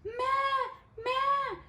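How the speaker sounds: high, playful goat call; goat bleat. Acted. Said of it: "said the goat".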